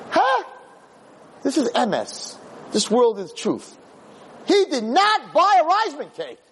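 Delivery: high, indignant protest; outraged disbelief. Acted. Tempter protesting the film to the crowd, high and outraged. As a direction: squeal that the record is wrong. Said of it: "And the Yetzer Hara is like".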